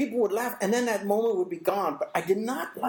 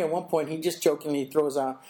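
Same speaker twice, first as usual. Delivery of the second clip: normal talking voice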